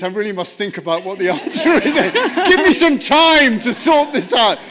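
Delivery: loud, high comic cry building through laughter; mock panicked outburst. Speaker jokes about what "great question" really means, mock desperate. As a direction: blurt it out as a hammed-up plea for time. Jokingly acts out stalling answerer's thought.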